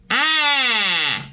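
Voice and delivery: angry cat twang demo on ah; twangy ah. Shown as a demonstration. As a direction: sing out a bright, pinched ah. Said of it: "and then make your sound".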